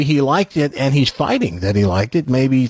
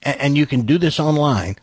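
ordinary talk, nothing marked

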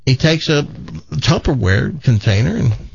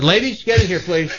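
calling out; 7 semitones higher than usual. Real, not acted. Host calls others into studio.

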